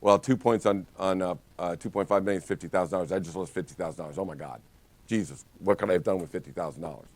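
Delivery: mock agent losing his nerve, fast and hushed; panicked inner monologue. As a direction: spiral into money panic under your breath. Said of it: "if you're like".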